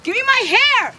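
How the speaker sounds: high, loud desperate cry; shouting. Real, not acted. Owner chasing the dogs for her weave, high desperate shout. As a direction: holler for your hair back.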